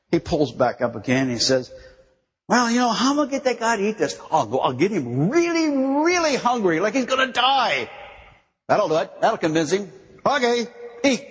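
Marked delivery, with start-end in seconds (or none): 2.5-9.9 playful scheming God. Acted. 10.2-11.2 God commanding. Acted.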